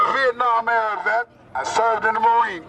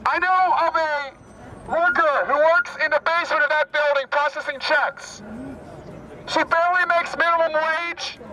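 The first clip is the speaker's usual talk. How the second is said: rally speech declamation; 8 semitones higher than usual. Real, not acted. Activist addresses an Occupy rally, declaiming phrase by phrase. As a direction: declaim in short phrases with long pauses.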